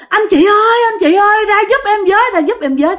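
high, urgent pleading cry; repeated calling for help. Retold or imitated. Girl knocking on a door for help, calling urgently. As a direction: keep calling up high, desperate to be heard. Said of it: "nó cứ đứng nó kêu hoài".

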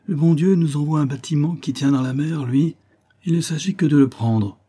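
rally the crew; rousing address. A narrator's reading. Lieutenant urging his sailors to seize the ship, rousing.

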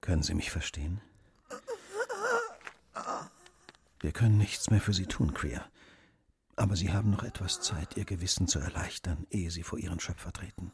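low, soft and grave; hushed to the dying. Acted. Detective urging the dying man to ease his conscience, hushed. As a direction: speak gently close to a dying man.

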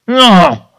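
sharp demon cry of refusal; demonic refusal. Retold or imitated. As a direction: recoil from the blessing like a possessed soul. Demon refusing the priest's hand.

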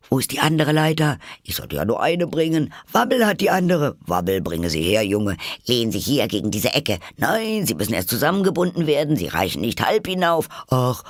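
hohe, hastige Stimmen durcheinander; aufgeregte Tiere. A narrator's reading. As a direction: ein wirrer Chor kleiner Tiere, aufgeregt. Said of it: "sie verstand die Worte". (aufgeregte Tierstimmen, hoch und schnell)

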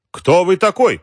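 high, loud demand; indignant challenge. A narrator's reading. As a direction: confront the intruder sharply. President challenges man who enters. (возмущённо, громко и высоко)

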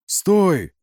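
loud, high-pitched cry; shouted halt. A narrator's reading. Narrator stops the departing soldiers, shouting. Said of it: "выкрикнул я".

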